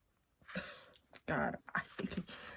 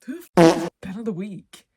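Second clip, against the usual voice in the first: loud baffled outburst; 14 dB louder, faster than usual. Retold or imitated. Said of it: "and I go".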